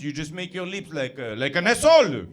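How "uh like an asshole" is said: louder, hammed-up French accent. Comedian demonstrating French lips, loud mock French accent. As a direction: pout out the words in a French accent. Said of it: "it's very easy to have a French accent".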